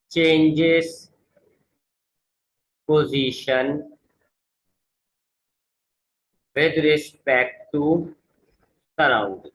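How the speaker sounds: very slow, each word set apart by long pauses; word-by-word dictation. Shown as a demonstration. Teacher dictating a definition to students, one word at a time, very slowly. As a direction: spell it out one word at a time for note-takers. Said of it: "dictating the second definition".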